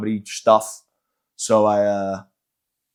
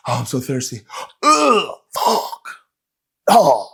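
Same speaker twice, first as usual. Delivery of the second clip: mock choking on vodka; 2 semitones higher, much slower than usual. Runner drinks vodka, chokes.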